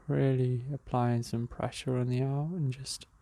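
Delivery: calm, unhurried meditation guide with long pauses; even, measured breathing-exercise voice; guided meditation. Real, not acted.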